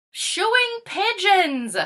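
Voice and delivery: gleeful, drawn-out answer; cheery exclamation. Acted. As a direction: kid proudly announcing his task. Caught moving, cheery reply.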